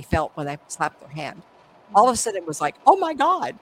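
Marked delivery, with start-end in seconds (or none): 2.8-3.6 loud, high gasp of amazement; surprised cry. Retold or imitated. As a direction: blurt it out in shock. Guests reacting with a scream.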